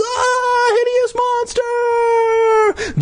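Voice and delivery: very high, loud shriek; squeaky scream. Acted. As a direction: shriek like a tiny terrified bug. Bee sees monster reflection.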